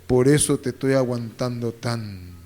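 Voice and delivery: low, soft voice of an enduring wife; hushed, resigned spouse; long-suffering wife. Acted. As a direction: voice a wife's quiet declaration. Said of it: "tu esposa te dice".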